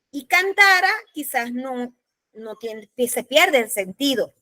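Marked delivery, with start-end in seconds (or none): none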